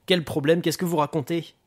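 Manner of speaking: rattled, irritated questions; alarmed demand. Acted. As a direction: challenge the detective in rising alarm. Booker alarmed at bad news.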